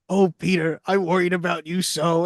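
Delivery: lightly voiced old man; a fond old dog greeting his boy; old dog's voice. Shadow running up to Peter, old man's voice. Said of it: "Shadow is an old man".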